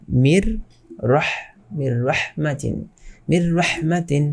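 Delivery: মির, রহ, then মির রহমাতিন built up piece by piece; merged without ghunnah. Shown as a demonstration. Teacher breaks it down for class, মির রহমাতিন merged on r. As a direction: sound out মির and রহ, then join them on a doubled r. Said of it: "মির রহমাতিন কি হবে".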